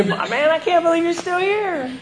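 high, amused teasing voice; a mock ribbing from an old friend. Quoting the friend's taunt.

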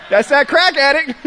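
a laughing self-roast; laughing mock self. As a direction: crack up calling yourself out. Mocking his own crack-addict thinking.

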